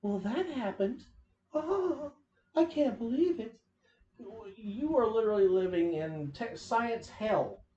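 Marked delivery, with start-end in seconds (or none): 1.5-3.6 mock shock. Mocking character's shock.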